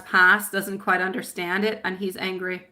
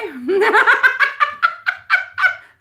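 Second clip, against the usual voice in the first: high, loud laugh; 10 dB louder than usual. Real, not acted. Psychic laughing about hoping her boo-boo goes away. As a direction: burst out laughing at herself.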